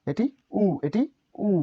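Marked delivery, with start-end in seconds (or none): 1.4-1.5 উ held as one higher-pitched vowel; vowel pronunciation. Shown as a demonstration. Teacher shows learners the letter's sound, উ pitched up. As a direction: model উ alone, pitched up. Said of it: "এটির উচ্চারণ উ".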